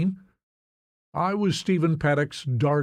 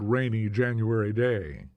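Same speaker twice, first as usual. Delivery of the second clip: plain reading voice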